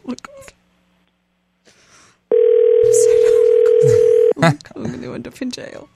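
breathy, panicked whisper. Real, not acted. Panics while on hold call.

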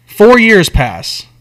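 dramatic narrator. Acted. Storyteller announcing the time jump, slow and dramatic.

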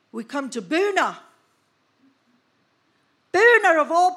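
loud, rising storyteller build; loud dramatic build-up; emphatic build. Real, not acted.

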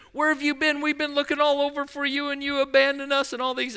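high-pitched frantic scolding; worried parents. Retold or imitated. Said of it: "they said".

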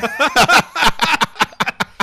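big loud burst of laughter. Real, not acted. Cracking up at his own work story, loud laugh.

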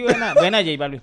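normal talking voice

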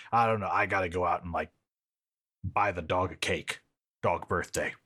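low, mumbled lazy excuse; detective making excuses. Retold or imitated.

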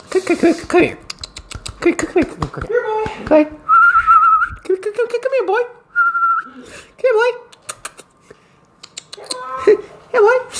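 high mock come-here-boy call; calling like to a dog. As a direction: coax him like a pet dog. Cops calling him like a dog.

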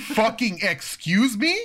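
slow, high, outraged disbelief; indignant. Retold or imitated. Father confronting the meddling lady, slow indignant disbelief. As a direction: drag it out like you can't believe her. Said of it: "And I was like".

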